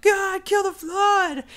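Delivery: high, drawn-out battle yell; gamer battle cry. Retold or imitated. Gamer spraying his machine gun at the Flood, mock battle cry. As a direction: stretch it into a high-pitched mock war cry. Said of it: "I'm spraying my machine gun like".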